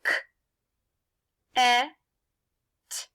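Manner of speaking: k, e, t said as separate sounds with long pauses; segmented letter sounds. Shown as a demonstration. Teacher finger-taps a syllable with pupils, separate sounds. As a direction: sound out "K, e, t" one by one. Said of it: "Finger tap this one with me".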